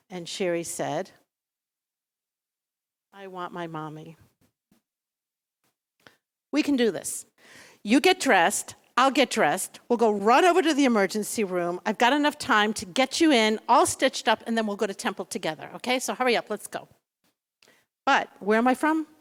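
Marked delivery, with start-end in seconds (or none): 3.1-4.2 put-on small child's plea. Hurt child wants her mother. 6.5-16.9 brisk mom pep talk. Retold or imitated. Mother rushing daughter to hospital.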